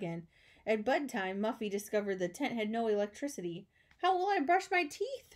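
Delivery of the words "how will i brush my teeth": high-pitched, put-upon wail; moaning complaint. A narrator's reading. Muffy finds the tent has no electricity, moaning. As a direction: wail the question in mild dismay. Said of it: "she moaned".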